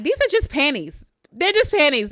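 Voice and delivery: lightly voiced carefree dancer; imitating carefree dancer. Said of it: "she like".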